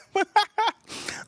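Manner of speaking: very high squeaky giggle; Mickey Mouse laugh. Real, not acted. Laughing at chat with a drink in his mouth, high squeaky laugh. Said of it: "like Mickey Mouse".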